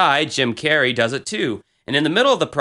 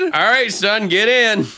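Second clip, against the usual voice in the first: hammed-up dad calling his son over; imitating dad; 7 semitones higher than usual. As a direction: play dad hollering from his pickup. Imagining dad pulling up in truck.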